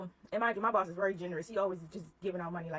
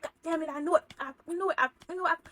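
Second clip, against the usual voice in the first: higher, louder imitated angry outburst; imitating an angry boss cursing; retold cussing; 7 semitones higher, 4 dB louder, faster than usual. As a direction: do the boss losing it, mock furious. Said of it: "start cussing".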